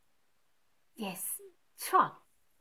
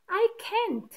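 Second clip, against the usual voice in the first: a lightly voiced snap, pitched high; a quick mock snap; snapping; 7 semitones higher than usual. Angry dog snapping back at the sheep, lightly acted. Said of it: "he snaps".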